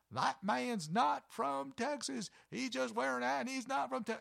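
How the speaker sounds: hammed-up high-pitched public accusation; mock screaming. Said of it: "she starts screaming".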